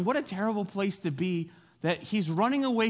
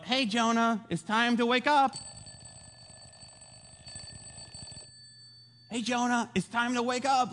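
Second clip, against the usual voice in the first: lilting, higher-pitched coaxing; gentle wake-up call; 3 semitones higher than usual. Retold or imitated. God gently rouses Jonah like an alarm clock, lilting and gentle. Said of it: "God is being gentle".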